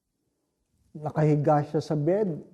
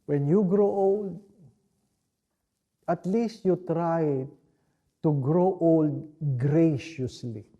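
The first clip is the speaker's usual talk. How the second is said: quoting a mentor; slower than usual. Retold or imitated. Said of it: "would always say this to me".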